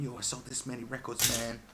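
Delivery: mock rapper bragging about money. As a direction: show off like a rapper in an interview. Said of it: "If you watch any interview going".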